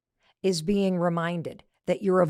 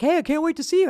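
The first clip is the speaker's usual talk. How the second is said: high, louder eager friend voice; Phil; 8 semitones higher, 5 dB louder, faster than usual. Retold or imitated. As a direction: imitate an excited friend's message. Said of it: "texts from her friend Phil".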